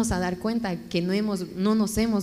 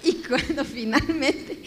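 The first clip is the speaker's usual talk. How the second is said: laughing; 4 semitones higher than usual. Real, not acted. Still laughing while continuing.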